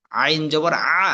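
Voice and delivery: "আ" squeezed from the throat; pressed ain. Shown as a demonstration. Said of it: "আইন চাপ". (গলায় চাপ দিয়ে "আ")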